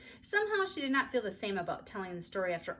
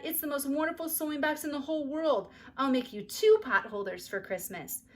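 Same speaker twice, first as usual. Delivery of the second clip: 1 excited; 6 semitones higher, 5 dB louder than usual. A narrator's reading. Overjoyed child thanks her aunt.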